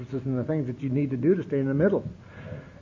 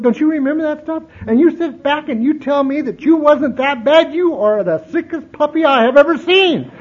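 higher and louder, scolding and insistent; sister scolding; 7 semitones higher, 7 dB louder than usual. Retold or imitated. Sister scolding her brother about his drinking, loud and insistent. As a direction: pile up the charges against him.

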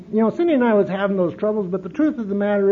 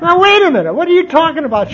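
much higher and louder, laughing; laughing protest; 11 semitones higher, 11 dB louder than usual. Retold or imitated. Protesting to his sister while laughing hard. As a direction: protest through big laughter. Quoting himself, story laughter.

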